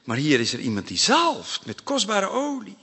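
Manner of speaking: normal talking voice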